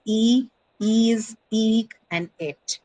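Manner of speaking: "e, ees, eek, et" as separate drawn-out endings; long vowels. Shown as a demonstration. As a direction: stretch and space out "e, ees, eek, et". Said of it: "because it has a long vowel in it".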